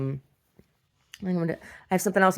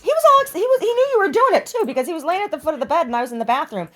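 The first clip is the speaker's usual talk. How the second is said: much higher and louder, bubbling over; excited pet talk; 13 semitones higher, 9 dB louder than usual. Real, not acted. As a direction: gush about the dog. Excited chat about the dog.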